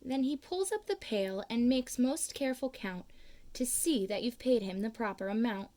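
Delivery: usual speaking voice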